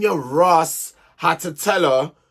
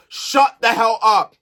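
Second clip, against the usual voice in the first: slow, louder telling-off; scolding; 3 semitones higher, 5 dB louder, slower than usual. Real, not acted. Vlogger telling off Curtis over his comments, scolding. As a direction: spell out the annoyance with force.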